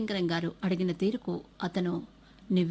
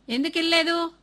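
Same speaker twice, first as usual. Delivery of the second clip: ఎందుకు వినలేదు? barked loud and high; the father snaps ఎందుకు వినలేదు? at his son; father angrily demanding why the boy did not listen; angry demand; 9 semitones higher, 6 dB louder than usual. Acted. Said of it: "తండ్రి అడిగాడు".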